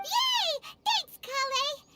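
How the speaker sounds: high, gleeful thanks; delighted cheer. Acted. Companion thanks cook for the recipe, delighted high cheer.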